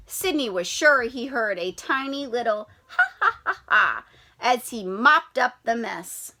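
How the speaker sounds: usual speaking voice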